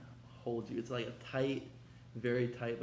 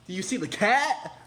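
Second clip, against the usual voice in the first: higher and much louder, pushing the word cat; loud insistent question; 9 semitones higher, 11 dB louder than usual. Real, not acted. Showing viewers the cat print, loud and insistent. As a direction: demand they notice the cat.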